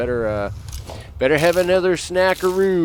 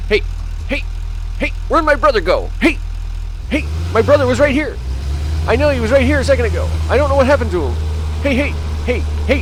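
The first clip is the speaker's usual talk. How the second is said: high, frantic mock panic from a lost buddy; panicked enemy; 9 semitones higher, faster than usual. Quoting a panicked enemy looking for his brother, high and frantic. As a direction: squeal it like a goon who lost his brother. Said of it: "He's like".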